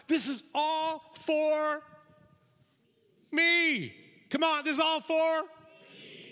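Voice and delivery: clutch it all as yours; gloating greed. Acted.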